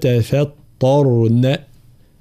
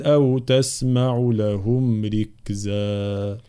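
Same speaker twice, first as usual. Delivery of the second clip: Quran recitation; 3 semitones higher than usual. Acted. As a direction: recite it with measured tajweed. (تلاوة مجودة)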